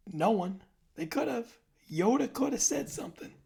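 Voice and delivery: outraged that nobody told him; indignant. Real, not acted. Indignant, pitch jumps way up.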